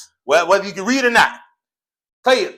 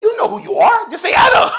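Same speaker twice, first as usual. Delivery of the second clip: high coaxing encouragement; 13 semitones higher, 5 dB louder than usual. Real, not acted. Coaxing a shy student.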